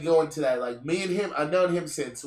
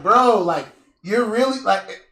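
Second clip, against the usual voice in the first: mild mock anger; 4 semitones higher, 6 dB louder than usual. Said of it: "and I'm mad".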